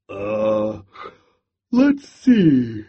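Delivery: sleepy yawning start, then a lift. A narrator's reading. Bear, half asleep, answers Hare with a yawn. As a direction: yawn through the words. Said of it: "Bear said with a yawn".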